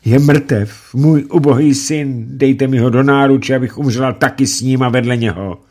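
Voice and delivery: high, loud desperate lament; mother screaming over her son. Acted. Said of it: "Setkin křičela".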